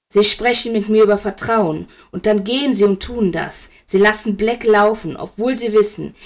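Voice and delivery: heated, forceful reproach; angry accusation. Acted. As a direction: accuse him, pushing hard on each phrase. Severus accuses Dumbledore, enraged.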